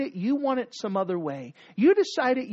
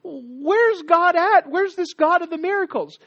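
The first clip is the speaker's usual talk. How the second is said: complaining Gideon; 7 semitones higher, 6 dB louder than usual. Retold or imitated. Gideon complaining to God, high and loud. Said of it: "Gideon's gonna complain".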